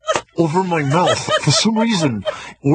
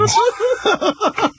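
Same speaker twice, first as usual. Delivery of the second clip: laughing. Real, not acted. Hosts cracking up at bit.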